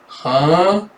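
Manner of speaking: drawn-out rising puzzled huh; baffled child. Retold or imitated. Child processing teacher's lecture, lightly mocked bafflement. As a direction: let confusion climb slowly. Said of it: "She's trying to process".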